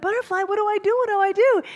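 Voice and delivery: desperate plea. Acted. Stranded little eagle pleading with the butterfly, high and desperate. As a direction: cry out to the butterfly like a scared small bird.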